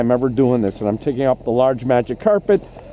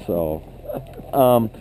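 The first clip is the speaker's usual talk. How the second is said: usual speaking voice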